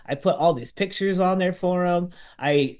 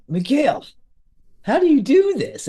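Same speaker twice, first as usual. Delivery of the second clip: much higher and louder, astonished; amazed shout to the guide on the boat; 10 semitones higher, 6 dB louder than usual. Retold or imitated. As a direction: cry out in disbelief at the whale.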